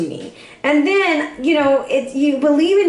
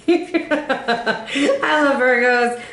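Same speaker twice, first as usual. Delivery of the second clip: real laughter spilling into the words; laughing; much slower than usual. Real, not acted. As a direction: say it fondly through a laugh. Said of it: "I'm sorry I'm laughing".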